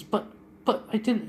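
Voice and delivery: high-pitched pleading objection; stammered protest. Retold or imitated. Niece objects to uncle's punishment, stammered protest. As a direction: squeak out a faltering objection.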